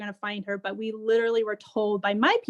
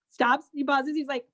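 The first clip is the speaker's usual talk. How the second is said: ordinary delivery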